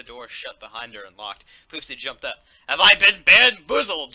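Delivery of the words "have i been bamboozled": loud, high and slow gasp of shock. Acted. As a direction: cry out in disbelief. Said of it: "she asked in shock".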